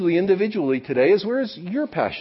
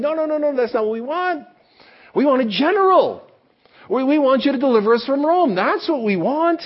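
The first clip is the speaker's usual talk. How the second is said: crowd voiced insistently; 10 semitones higher, 4 dB louder than usual. The crowd voiced rejecting Jesus.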